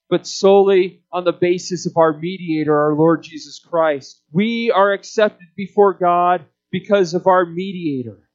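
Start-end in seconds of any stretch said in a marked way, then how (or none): none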